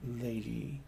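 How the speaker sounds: slow, soft courtly address; lightly voiced nobleman, hushed; noble's greeting. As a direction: savor the name like a smitten lord.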